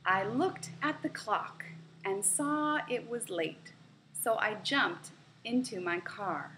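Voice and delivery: clear, even read-aloud for learners; story reading. A narrator's reading. Teacher reading a practice story to English learners, clear read-aloud. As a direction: read the short story out for the class to follow.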